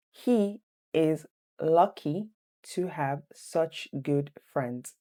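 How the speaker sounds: very slow, chunked quiz reading; slow, deliberate dictation for students. Shown as a demonstration. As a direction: break it into two slow halves for learners. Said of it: "Number two".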